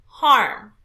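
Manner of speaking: HARM punched loud, then falling; stress on HARM. Shown as a demonstration. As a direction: hit "Harm" hard as a stressed syllable. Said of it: "We have to raise our pitch".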